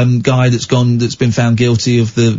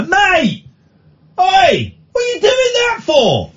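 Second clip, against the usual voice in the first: high-pitched yell across the road; shouting out the window; slower than usual. Retold or imitated. Driver yelling at a bottle smasher across the road, shouting. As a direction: call out sharply at a distant stranger. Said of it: "I started shouting out of the window".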